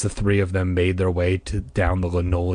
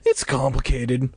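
plain reading voice

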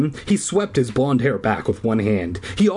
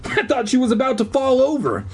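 laughing; 12 semitones higher than usual. Real, not acted. Reader laughing at the story.

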